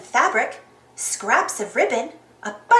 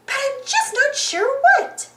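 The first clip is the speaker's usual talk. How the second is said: very high cartoon mouse; high-pitched puzzled character; puzzled Minnie; 12 semitones higher than usual. A narrator's reading. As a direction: ponder in a high squeaky voice. Minnie voiced in read story.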